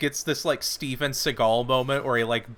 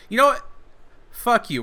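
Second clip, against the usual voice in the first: angry outburst; 9 semitones higher, 5 dB louder, faster than usual. Retold or imitated. Retold outburst at townsfolk.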